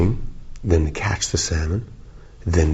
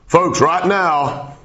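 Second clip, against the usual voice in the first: high, slow, booming announcer blast; sudden blast; 11 semitones higher, 4 dB louder, much slower than usual. Pretend. Jolting the listener as a TV announcer, booming. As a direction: blow their ears off with it. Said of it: "Just thought I'd blow your head off".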